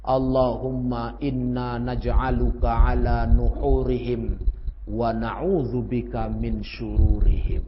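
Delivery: slow reverent chant-like reading; recited prayer. Shown as a demonstration. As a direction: recite the Prophet's prayer slowly. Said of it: "baca kata Rasul".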